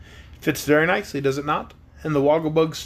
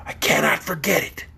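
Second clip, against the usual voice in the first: whispered, far-off horse; Sawhorse whisper. A narrator's reading. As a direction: breathe it out.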